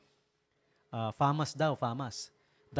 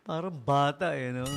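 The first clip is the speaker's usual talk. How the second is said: normal talking voice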